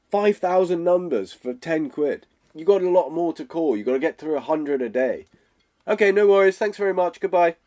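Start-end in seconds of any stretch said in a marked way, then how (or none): 5.9-7.5 bright, higher cheerful goodbye; polite sign-off. Shown as a demonstration. Coach shows hanging up politely after a no, cheerful and higher. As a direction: wrap it up cheerfully. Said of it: "Okay, they said no".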